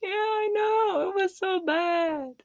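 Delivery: high, drawn-out fake wail; mock crying. Book club host lamenting a terrible pick, mock crying. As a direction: whimper it slowly, hammed-up and high.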